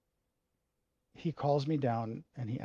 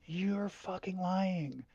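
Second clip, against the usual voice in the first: dad yelling; 8 semitones higher than usual. Retold or imitated. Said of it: "he was like".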